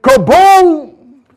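loud, drawn-out "kaboom"; booming crash imitation. Preacher recreating a crash in his house, loud booming imitation. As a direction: boom it out like a big crash.

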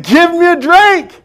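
high, slow, drawn-out voice of Jesus; Jesus asking. Acted. Preacher voicing Jesus asking the woman for a drink, high and slow.

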